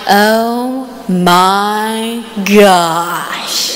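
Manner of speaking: very slow, drawn-out retold shock; reenacted awe, word by long word; stunned disbelief. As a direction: stretch every word in low, dazed amazement. Retold shock at seeing the proposal.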